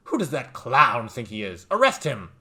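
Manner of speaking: loud, sneering voice of a talking necktie; the tie barks at the clown, loud and mocking. The talking necktie jeers at the bartender, loud and scornful.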